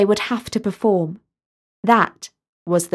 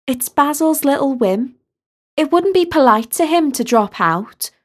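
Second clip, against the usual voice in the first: a touch higher, gently persuasive friend; lightly voiced Sally; 5 semitones higher than usual. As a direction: coax her to stay in the game. Sally explains the game to Talia.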